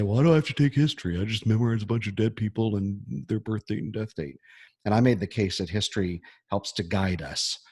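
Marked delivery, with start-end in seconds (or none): 0.0-4.4 mock student voice. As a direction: impersonate a reluctant student brushing off history. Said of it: "we say".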